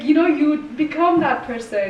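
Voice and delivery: ordinary talk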